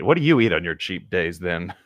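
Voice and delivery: ordinary talk, nothing marked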